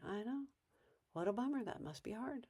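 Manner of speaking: very soft, soothing sympathy; calm sympathy. Shown as a demonstration. Parent soothing a protesting kid, calm and lovingly soft. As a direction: answer gently, refusing to be rattled. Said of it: "'cause you're being calm".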